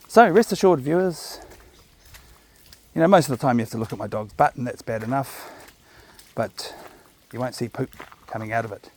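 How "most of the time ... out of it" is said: normal talking voice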